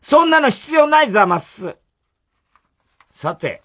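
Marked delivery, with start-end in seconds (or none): 0.0-1.8 high haughty madam voice; snobbish zamasu lady. Acted. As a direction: sniff it out like a rich madam. Zamasu mother answers.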